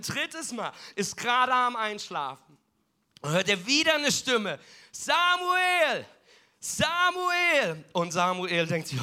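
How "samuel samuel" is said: much higher, louder drawn-out call; a ringing heavenly call; insistent calling. Acted. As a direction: call the name from afar, the third and strongest time.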